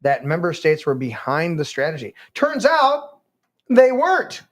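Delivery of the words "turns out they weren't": mock punchline. Real, not acted.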